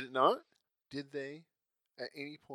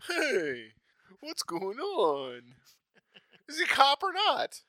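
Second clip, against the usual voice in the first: a hint of a confused cop; slow, rising bewilderment; clueless cop voice; 9 semitones higher, 5 dB louder, much slower than usual. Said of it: "He just now looks around and goes".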